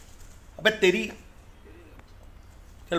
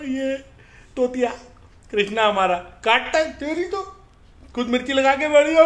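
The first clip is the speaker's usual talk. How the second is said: higher and louder, laughing more as it builds; excited laughing; 7 semitones higher, 6 dB louder than usual. Real, not acted. Owner laughing loudly about his biting parrot. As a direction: laugh it out, getting higher as you go.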